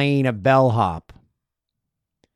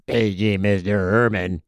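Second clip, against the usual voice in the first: bellhop page; 5 semitones lower than usual. Retold or imitated. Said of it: "Let me try that again".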